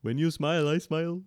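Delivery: put-on sing-song Indian accent. Asked to do an Indian accent on the podcast.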